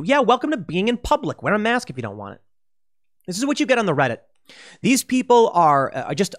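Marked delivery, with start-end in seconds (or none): none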